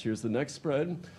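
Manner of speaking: usual speaking voice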